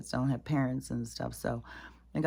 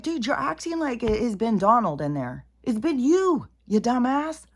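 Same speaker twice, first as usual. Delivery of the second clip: higher, louder exasperated tirade; angry rant; 9 semitones higher, 6 dB louder than usual. Real, not acted. Venting at Kamala on camera, high and loud. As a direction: really let her have it.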